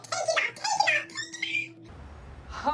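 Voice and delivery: very high, panicked shriek; imitating a trapped woman's high hysterical scream. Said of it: "screaming hysterically".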